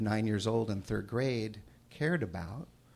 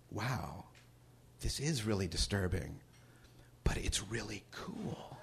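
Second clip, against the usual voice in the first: hushed awe; 8 dB quieter than usual. Retold or imitated. Retelling her own reaction to the book.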